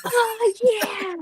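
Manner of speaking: high, imitated whoop; retold cheering scream. Mom replaying her cheer for Waylon, whooping. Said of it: "I screamed a bloody murder".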